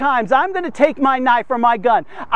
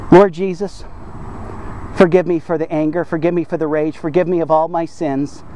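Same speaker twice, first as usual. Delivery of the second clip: low, hushed pleading prayer; earnest prayer; 7 semitones lower than usual. Acted. Preacher leading listeners in a prayer of repentance, low and earnest.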